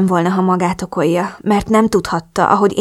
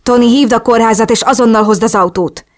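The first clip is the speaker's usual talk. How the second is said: louder, higher urgent order; urgent shout; 3 semitones higher, 5 dB louder than usual. A narrator's reading. Drew orders Tony to bring the car, urgent shout. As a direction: call out an emergency command. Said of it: "hogy hangosan kiabál".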